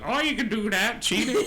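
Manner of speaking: mock-accusing the party of cheating; teasing old woman. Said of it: "she goes".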